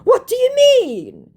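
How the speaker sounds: very high, slow, loud protest; mock indignation. Scammer accused of hustling, mock indignant. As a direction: wail it in exaggerated bafflement.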